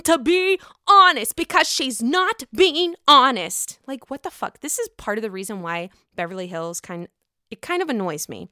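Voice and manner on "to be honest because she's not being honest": louder, higher, drawn-out mock complaint; exasperated mockery. Host mocks Lisa pushing honesty, exasperated mockery. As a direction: hammer out each word in mock exasperation. Mocking a castmate's honesty refrain.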